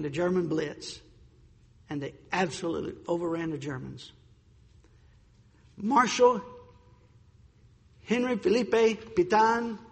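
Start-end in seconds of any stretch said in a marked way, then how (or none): 5.8-9.8 higher-pitched attempt at a French accent. Shown as a demonstration. Speaker showing off his best French accent, lightly put on French accent. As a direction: say the name in your best amateur French. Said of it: "that's my best French accent I've got".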